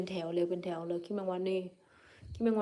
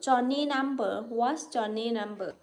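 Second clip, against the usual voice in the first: drawn out English; 2 semitones higher, much slower than usual. Real, not acted. Streamer spelling out strange English to viewers, very slowly. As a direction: stretch each English word out carefully. Puzzling over English words.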